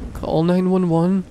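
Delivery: loud, urgent command; loud order. Acted. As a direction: bark it as an order. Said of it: "I ordered".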